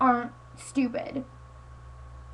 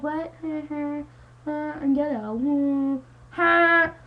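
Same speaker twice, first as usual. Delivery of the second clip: mocking Brenna impression; 3 semitones higher, much slower than usual.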